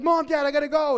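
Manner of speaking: high, loud goodbye shout; hurried call to parents. Acted. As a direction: call out eagerly as if running off. Acted son calling while running off.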